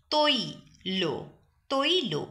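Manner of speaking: তৈল said toilo with a final o; o-ending pronunciation. Shown as a demonstration. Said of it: "অ উচ্চারণ হয়ে গেছে ও এর মতো". (তৈল শেষে ও-ধ্বনি দিয়ে)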